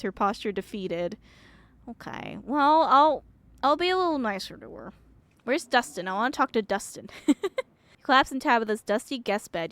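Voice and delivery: ordinary talk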